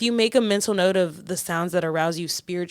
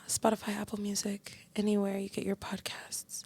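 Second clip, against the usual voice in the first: soft sultry whisper; 3 semitones higher, 6 dB quieter than usual. Pretend. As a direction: breathe it out quietly. Whispered sign-off plug.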